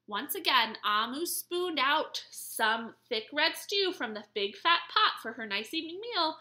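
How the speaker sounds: ordinary delivery, nothing marked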